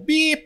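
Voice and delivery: high-pitched mock car horn; imitated horn beep. Rider retells being honked at from behind, imitated horn beep. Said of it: "binubusinahan ako".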